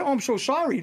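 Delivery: retold apology. Retelling his apology to production.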